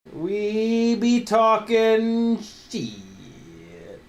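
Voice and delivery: slow, high, drawn-out tease; playful drawl. Pretend. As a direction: stretch it out mockingly. Playful self-mocking line.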